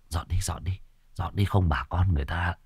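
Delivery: hushed whisper. Acted. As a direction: whisper urgently to hurry. (thì thầm giục)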